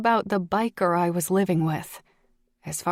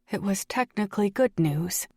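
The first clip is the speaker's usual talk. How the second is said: usual speaking voice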